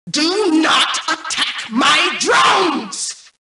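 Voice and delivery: slow, high, loud warning; a machine losing its temper; drawn-out enraged command; furious robotic shout. Acted. Angry AI command.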